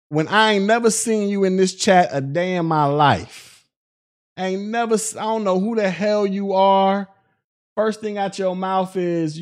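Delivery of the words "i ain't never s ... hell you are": ordinary talk, nothing marked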